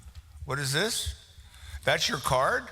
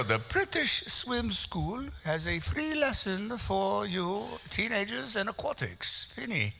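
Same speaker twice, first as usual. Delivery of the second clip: higher-pitched, softer put-on British accent; mock British accent; 6 semitones higher, 4 dB quieter than usual. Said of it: "That's my British accent".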